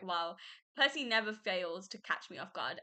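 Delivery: normal talking voice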